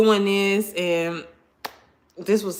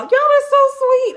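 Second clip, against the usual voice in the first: high, loud sweet squeal; gushing gratitude; 7 dB louder than usual. Real, not acted. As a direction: squeal it at the viewers, touched. Touched by viewers' kind comments.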